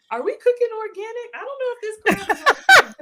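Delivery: high, loud, laughing self-quote; laughing joke. Retold or imitated. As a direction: crack up while quoting your own confusion. Jokes about the word organic.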